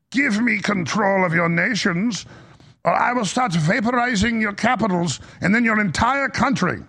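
a hint of a space-station villain gloating; comic supervillain. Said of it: "sounding like a Marvel comic supervillain".

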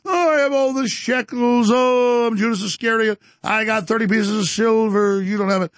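high, loud sneering mock laugh; cackling impression of a gloating rival; mocking laughter. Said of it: "He's like".

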